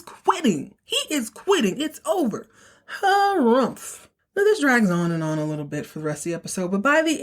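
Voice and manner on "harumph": high huffy harrumph; mock harrumph. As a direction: huff like a sulking child. Mocking his sulky quitting.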